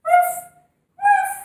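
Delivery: high, loud playful barking; cheerful mock dog bark; happy dog woof. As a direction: bark like an excited puppy for the kids. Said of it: "let's go like happy puppies".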